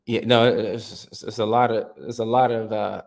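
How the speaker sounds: usual speaking voice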